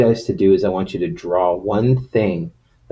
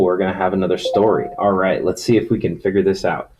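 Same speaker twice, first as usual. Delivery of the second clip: ordinary talk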